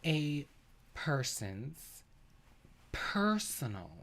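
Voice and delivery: slow condescending near-whisper; slow and quiet. Shown as a demonstration. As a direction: spell it out slowly as to a child. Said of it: "I'm gonna say it slowly".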